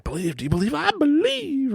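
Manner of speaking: put-on high-pitched revival of a child's belief; mock fervor. Dad replays the game with son, high fervent imitation. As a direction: chant it high and eager.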